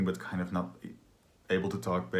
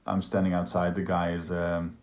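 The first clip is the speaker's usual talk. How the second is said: usual speaking voice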